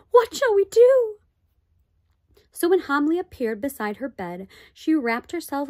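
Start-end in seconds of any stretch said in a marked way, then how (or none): 0.1-1.2 high, loud anxious cry; heartfelt cry. A narrator's reading. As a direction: cry out in worry, much higher and louder. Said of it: "a heartfelt cry of".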